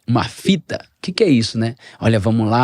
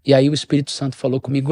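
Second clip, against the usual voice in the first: ordinary talk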